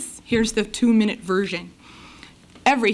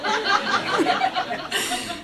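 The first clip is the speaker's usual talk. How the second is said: high laughter; 12 semitones higher than usual. Real, not acted. Laughing at her own story.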